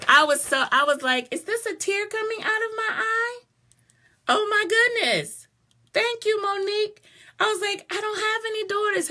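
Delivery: excited. Real, not acted. Excited about National Daughter Day.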